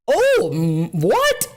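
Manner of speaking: loud, high-pitched real disbelief; incredulous. Real, not acted. Streamer learning slapping children is not allowed, incredulous. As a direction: blurt it out in shock.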